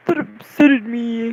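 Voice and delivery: slow, loud goofy echo of UPSETTED; mocking own slip. Vlogger making fun of their wrong word, mocking. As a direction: drag out the silly word, mocking yourself.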